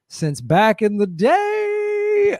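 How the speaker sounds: high, long-held final word; mock-cheery drawl that climbs at the end; drawn-out exclamation. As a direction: stretch it out, rising high. Mock-celebrating long complicity with Nazis.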